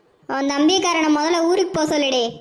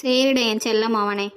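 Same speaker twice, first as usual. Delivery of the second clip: fond; 6 semitones lower than usual. Acted. Mother fondly backs younger son.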